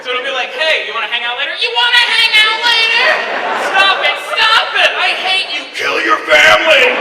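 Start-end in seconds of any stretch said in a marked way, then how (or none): 1.6-3.2 high, loud, slow mimicry; exaggerated taunting singsong copy; mocking echo. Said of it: "third person on the line making fun". 3.7-5.6 high, loud, slow plea; mock-distressed raised cry; upset protest. Comic quarrel with own mocking echo. 5.7-6.9 loud, slow, menacing shout; hammed-up sinister yell; evil voice on the line. Echo turns into evil commanding voice.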